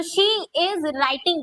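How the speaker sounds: each word drawn out slowly for copying; teacher dictating slowly; slow dictation. Shown as a demonstration. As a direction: spell it out word by word for the class. Teacher dictating English sentence.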